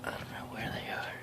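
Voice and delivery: hushed sneaking whisper; dad creeps up on the nest, whispering. Real, not acted. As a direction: whisper so the wasps won't hear. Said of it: "whispering while hunting wasps".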